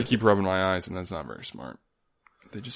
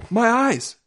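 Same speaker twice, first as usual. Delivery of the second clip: much higher, loud anguished cry; Mark Wahlberg impression; 13 semitones higher, 8 dB louder than usual. Andy Samberg impression doing Mark Wahlberg, loud high cry. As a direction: wail it like a panicked movie hero. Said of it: "That's my Mark Wahlberg saying".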